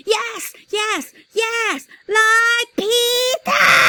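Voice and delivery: put-on high, loud, drawn-out childish holler. A narrator's reading. Fudge hollers at the salesman for shoes like Peter's, a high, loud, drawn-out holler. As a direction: holler it like a stubborn toddler. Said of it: "Fudge hollered".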